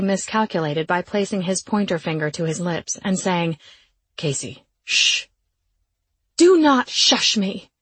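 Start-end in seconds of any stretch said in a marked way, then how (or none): 4.2-5.2 low, slow calm male voice; low hushing man. A narrator's reading. Jake hushing her, low voice. 6.4-7.7 loud slow snarl through teeth; snarled through gritted teeth. A narrator's reading. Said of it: "I snarled through gritted teeth".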